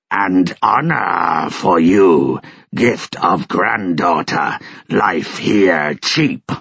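slow, measured beast-man speech; slow alien solemnity; slow dog-creature. A narrator's reading. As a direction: speak of death coldly and slowly. Snowy speaks of death.

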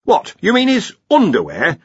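high, loud puzzled query. Acted. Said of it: "who could be a bit slow".

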